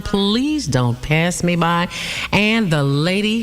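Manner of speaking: usual speaking voice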